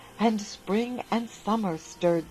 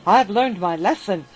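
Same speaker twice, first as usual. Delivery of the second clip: grab at it, cry for more and more; greedy cry; 9 dB louder than usual. A narrator's reading. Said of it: "he said".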